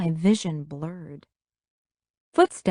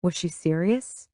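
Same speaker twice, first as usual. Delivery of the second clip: ordinary delivery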